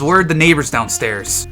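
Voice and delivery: normal talking voice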